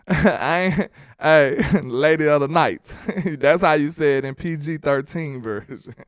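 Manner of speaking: talking through laughter; chuckles breaking up the words; real laughing. Real, not acted. Streamer amused by own ladies of the night joke, laughing.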